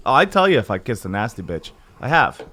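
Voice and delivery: ordinary delivery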